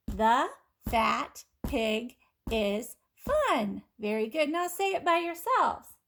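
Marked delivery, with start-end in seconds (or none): 0.1-3.8 very slow, words drawn out together; slow choral reading. Shown as a demonstration. Teacher reads along slowly with the class. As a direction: lead the class through it slowly. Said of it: "Now say it with me".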